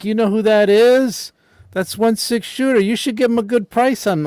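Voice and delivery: loud, high-pitched impression; big, high put-on voice, pressing the point; Iz Ramirez. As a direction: push the voice way up and talk someone up loudly. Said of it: "try and do my Iz Ramirez".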